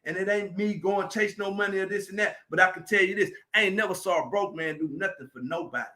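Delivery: normal talking voice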